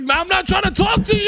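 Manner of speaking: yelling it off high; high mock shout. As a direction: shout the brush-off. Retold refusal, shouted.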